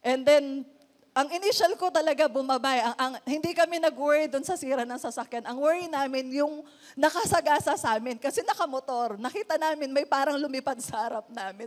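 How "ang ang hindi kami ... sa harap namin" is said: ordinary talk, nothing marked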